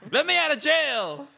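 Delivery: high, loud drawn-out wail; mock pleading yell. Caged parakeet demands release, hammed-up slow yell. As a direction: holler it slowly like a hammy prisoner.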